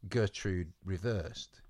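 ordinary delivery

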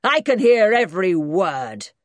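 put-on loud, indignant old granddad; shouting old man. A narrator's reading. Granddad to the whispering American, indignant shout. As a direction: shout it crossly across the room. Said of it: "shouted Grandad".

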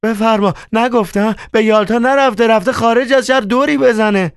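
I told you so, excitedly. Acted. Manager tells the accountant, high and excited. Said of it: "مدیر داخلی هیجان زده گفت".

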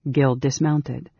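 ordinary delivery, nothing marked

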